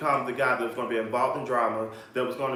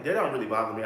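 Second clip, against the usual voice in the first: ordinary delivery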